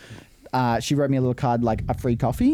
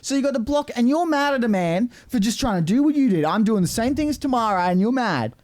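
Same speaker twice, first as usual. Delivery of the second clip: high, indignant mock rant; mock outrage; 9 semitones higher than usual. Hosts teasing Tamara, high mock outrage. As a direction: act scandalised on behalf of the other driver.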